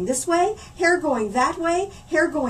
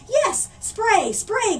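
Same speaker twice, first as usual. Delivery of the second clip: crying out eagerly; 9 semitones higher, 3 dB louder, much slower than usual. Acted. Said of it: "cried out Mom and Emily".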